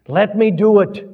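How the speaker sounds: loud, high yell. A narrator's reading. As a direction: strain against being held back. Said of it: "yelled Salis".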